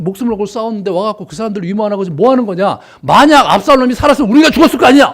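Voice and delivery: high, loud, fast furious scolding; angry rebuke. Retold or imitated. Joab berating David for mourning, loud angry rebuke. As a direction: tear into the king for weeping. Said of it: "막 화를 냅니다".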